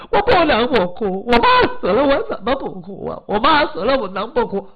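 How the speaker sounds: put-on high, loud tearful girl; acted bereaved daughter's cry; crying protest. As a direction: protest through tears, loud and insistent. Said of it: "你别哭了".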